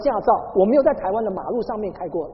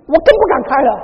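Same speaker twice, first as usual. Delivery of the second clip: louder, higher cry of not daring to drive; exclaimed fear; 7 semitones higher, 11 dB louder than usual. Retold or imitated. Preacher telling congregation of traffic fear, loud exclaim. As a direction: throw it out as a loud comic protest.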